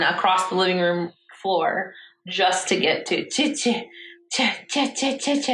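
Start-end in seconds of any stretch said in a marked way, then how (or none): 3.3-5.5 imitating toddler. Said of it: "he just keeps saying it".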